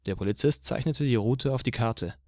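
ordinary delivery, nothing marked

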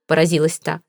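usual speaking voice

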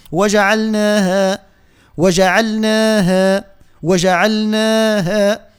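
وجعلناها linked up, both long vowels held equally; even, drawn-out naa and haa in وجعلناها; joined, balanced elongations. Shown as a demonstration. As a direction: slowly chant وجعلناها as one connected word. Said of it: "باش يكون توازن، آه، بين المدود الطبيعية".

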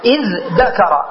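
"إذ ذكر" slow, in two parts, dh then d; changed form. Shown as a demonstration. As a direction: draw out "إذ ذكر" as idh-dakara. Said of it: "وتقول إذ".

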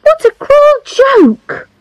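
high, drawn-out indignant exclamation; shocked cry. A narrator's reading. Charlotte protests at a cruel joke, indignant cry. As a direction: cry it out slowly, pitch soaring. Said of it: "cried Charlotte".

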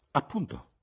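high, eager burst of agreement; excited exclamation. A narrator's reading. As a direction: jump in excitedly. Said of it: "esclamò Sir Harry eccitato".

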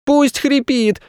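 put-on high-pitched bratty boy's yell; boy shouting. A narrator's reading. Boy defying his mother at the zoo, shouting. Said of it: "кричал Федя".

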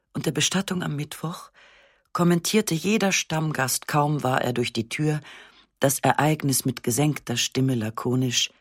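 ordinary delivery

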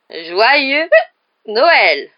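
high, very loud cheer; hearty toast. A narrator's reading. Knight toasting pupils' health, loud and hearty. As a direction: bellow the greeting like a boisterous knight. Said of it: "lança-t-il".

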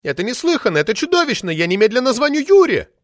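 put-on high, loud indignant shriek of an old party lady; shrill furious official lady yelling; roaring outrage. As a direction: howl it out like an enraged sabre-toothed tigress. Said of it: "рыча".

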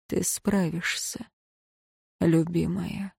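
mouthed, very soft encouragement; tender breathy whisper, barely there; whispered pep talk. Acted. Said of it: "он прошептал одними губами". (шёпот одними губами)

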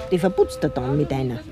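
ordinary delivery

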